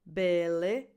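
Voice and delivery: bele with both vowels like the I in it; lax vowels. Shown as a demonstration. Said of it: "same vowel that you have in the pronoun it".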